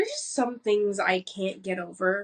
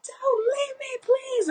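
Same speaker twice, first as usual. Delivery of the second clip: high wailing plea, crying and begging; hammed-up sobbing beg in a high voice; mock sobbing cheater. Mocking a caught cheater, high pleading sobbing. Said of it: "I catch a cheater and they're like".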